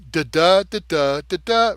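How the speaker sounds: da da chanted with the beat on every second da; iambic da da rhythm. Shown as a demonstration. Explaining meter, chanting iambic da rhythm. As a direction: tap out iambic beats on da. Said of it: "what I mean is".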